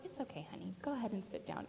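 soft, low, gentle reassurance; tender teacher letting a pupil off; sweet teacher. Retold or imitated. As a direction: soothe a child very quietly. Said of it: "she was a really sweet teacher".

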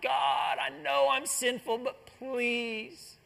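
starts high, fades to a soft plea; mild penitent cry fading away; humble plea. Acted. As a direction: beg forgiveness, ashamed. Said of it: "he cries out".

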